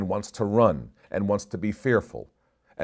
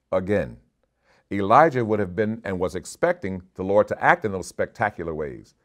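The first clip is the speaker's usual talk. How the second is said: usual speaking voice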